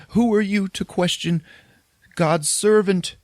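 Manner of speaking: high-pitched mock outrage; frightened, indignant priest. Shaking priest challenging the Tall Man, in high mock indignation. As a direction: protest in a high, hammed-up voice.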